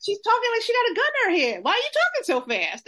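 high, fast, mocking voice; squeaky rushed imitation; mocking the neighbor. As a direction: rattle it off high like a puzzled neighbor. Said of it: "And he's like".

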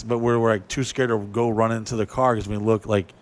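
normal talking voice

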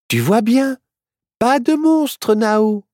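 high, cheerful, matter-of-fact; girl's voice. A narrator's reading. Myrtille shows Nao there is no monster, high and bright. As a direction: prove him wrong, kindly. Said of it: "lui dit Myrtille".

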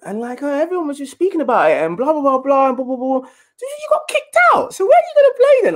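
high, fast, fussy mum voice; mum's voice. Retold or imitated. Mum asking her son about his club, high quick voice. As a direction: squeak it like a worried mum.

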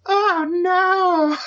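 high squeaky Susie Soccer cry. Acted. Podcast host voicing his Susie Soccer character, high and panicked. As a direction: shriek it out as the panicked girl character. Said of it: "And Susie Soccer goes".

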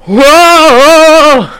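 high, loud showy burlesque whoa; burlesque belt. Retold or imitated. Imitating the actress's burlesque number, loud and high. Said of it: "right, like that".

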